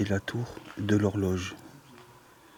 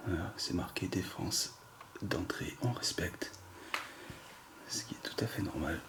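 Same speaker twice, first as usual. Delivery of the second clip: whispering; 2 semitones lower, 8 dB quieter than usual. Real, not acted. Reading a no-entry sign, hushed.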